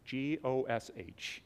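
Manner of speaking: G O S H spelled slowly letter by letter. Shown as a demonstration. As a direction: spell G O S H out, slow. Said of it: "the Christianese of that is".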